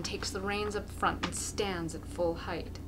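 ordinary delivery

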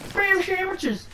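high-pitched goofy squeal; silly high voice. Pretend. Player teasing about sandwiches, silly high voice. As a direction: pipe it up very high.

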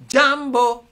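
loud call. Shown as a demonstration. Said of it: "I'll sing 'em".

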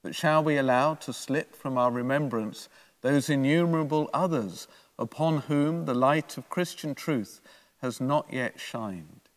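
ordinary talk, nothing marked

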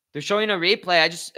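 usual speaking voice

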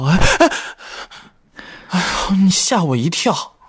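startled. Acted. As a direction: jump as if crept up on.

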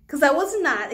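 high, loud, laughing through the words; nervous laugh. Real, not acted. Laughing at her own haircut mistake, nervous laugh.

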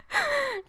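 a high squeaky giggle; high laugh. Real, not acted. Gamer too excited to talk, high giggle.

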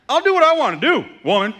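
grumpy outburst. Retold or imitated. Pastor acts out his grumpy reaction to wife, mock yell. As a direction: blow up like a cranky husband. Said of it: "That's how I start to feel".

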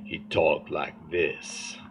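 put-on low, slow, solemn old preacher; old pastor's voice. Mimicking the old pastor to the congregation, low and slow. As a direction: drawl it out like an elderly pastor. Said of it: "he had this voice like this".

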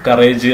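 കറേജ് as "ka-RAYJ"; long "-ayj" ending on കറേജ്; common mispronunciation. Shown as a demonstration. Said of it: "എന്ന് വായിക്കരുത്".

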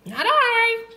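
very high pig squeal-voice; storybook pig. A narrator's reading. Pig refuses to help plant, very high character voice. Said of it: "said the pig".

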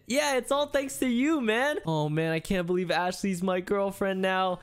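normal talking voice

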